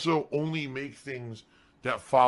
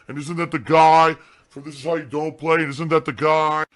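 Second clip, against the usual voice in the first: loud, raised, repetitive jeering; mocking accusers; 4 semitones higher, 9 dB louder than usual. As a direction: parrot the critics loudly, mocking them. Acting out his accusers' repeated questions.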